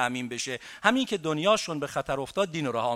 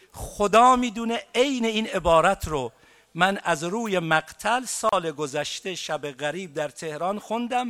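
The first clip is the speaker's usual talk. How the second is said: ordinary delivery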